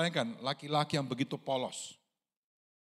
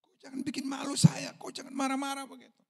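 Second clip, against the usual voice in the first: higher, indignant put-on voice for the king; the king snapping at the prophet not to shame him; Saul's defensive retort; 10 semitones higher than usual. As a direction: push back, wounded pride. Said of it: "dia bilang".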